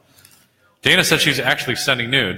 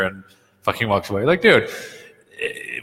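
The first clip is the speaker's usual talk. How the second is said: normal talking voice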